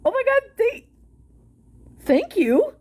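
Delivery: usual speaking voice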